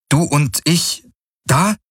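high, simplified question, word by word; halting question. A narrator's reading. Kirai asks Hocks by the map, high and halting. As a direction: ask carefully, lifting high on the last word.

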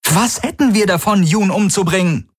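high, exasperated protest; crying out. A narrator's reading. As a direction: throw the question back in a high voice. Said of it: "rief Kirai".

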